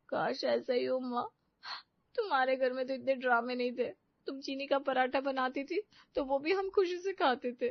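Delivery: a daughter weeping to her mother on the phone; tearful complaint. Acted. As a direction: pour out your hurt to mother while crying. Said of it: "फूट फूट कर रोते हुए".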